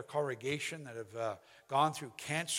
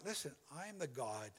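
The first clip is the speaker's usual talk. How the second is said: ordinary talk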